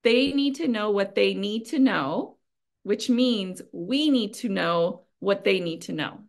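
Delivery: slowed down and firmly projected; slow, deliberate. Real, not acted. Speaker slows down for the translators, slow and deliberate. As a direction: say it slowly, weighting each word. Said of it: "I'll say it slow".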